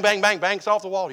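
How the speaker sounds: ordinary delivery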